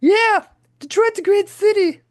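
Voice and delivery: very high, loud glam-rock purr. Retold or imitated. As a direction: croon it like a rock star. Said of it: "And he's like".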